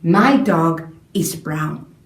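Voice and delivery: slow statement. Shown as a demonstration. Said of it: "So I'm going to say".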